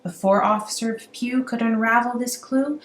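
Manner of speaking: usual speaking voice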